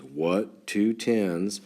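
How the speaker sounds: slow, deliberate classroom question; slow teacher question. Shown as a demonstration. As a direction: spell it out slowly for the class. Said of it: "this is the language that we use".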